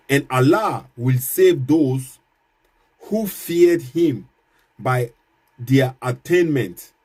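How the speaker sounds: slow, weighty reading with each word set apart; slow solemn verse reading. Shown as a demonstration. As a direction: read the verse like scripture in church. Reads Quran verse aloud.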